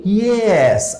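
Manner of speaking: usual speaking voice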